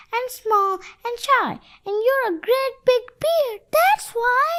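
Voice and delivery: put-on high squeaky scared little boy; small, high, frightened character voice. A narrator's reading. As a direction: squeak it like a timid child. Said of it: "a squeaky voice to the big giant bear".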